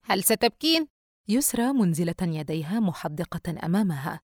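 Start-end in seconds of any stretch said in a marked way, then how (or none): 0.1-0.9 scoffing laugh, then higher taunt; mocking. A narrator's reading. Brother asking sister whether she will cry, mocking. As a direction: taunt her after a short laugh. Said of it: "وقال متهكمًا".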